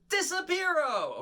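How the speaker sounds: Disappearo sung out high and drawn out; high, slow showman's Disappearo; magic word shout. Shown as a demonstration. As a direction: call Disappearo high for the kids to copy. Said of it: "everybody say Disappearo".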